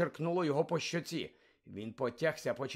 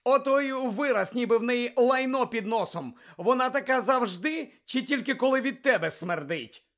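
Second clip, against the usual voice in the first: scornful mockery; 9 semitones higher, 7 dB louder than usual. Harry mocking Malfoy's mother.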